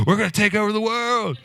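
high, hyped-up victory cry; pumped up. Retold or imitated. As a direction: imitate a pumped-up crew, pitch soaring, timbre changed. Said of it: "more pumped up than the guy was".

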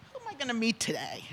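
soft, quiet wondering; musing aside. Retold or imitated. Woman at the door wondering whom she'd meet, musing softly. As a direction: muse it under your breath.

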